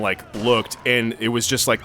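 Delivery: ordinary talk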